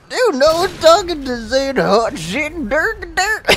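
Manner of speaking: very high, slow parody voice; mock him in a silly squeal. Mocking exchange with raging kid.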